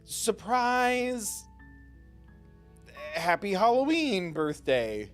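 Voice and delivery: loud slow surprise call; excited shout. Acted.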